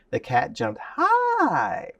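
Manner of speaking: "high" stretched out long, soaring up and falling; word painting on high. Shown as a demonstration. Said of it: "you gotta paint that picture".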